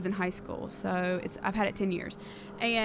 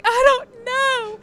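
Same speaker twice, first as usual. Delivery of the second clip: high, slow, louder wailing cry; imitating her own crying, high and slow; mock sobbing; 14 semitones higher, 6 dB louder, much slower than usual. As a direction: drag it out like a helpless sob. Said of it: "I'm sobbing".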